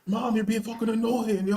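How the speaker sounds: put-on high, whiny teen brushing off his mom; mock the spoiled son snapping at mom; spoiled son snapping at his doting mom; bratty son. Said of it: "he'd be like".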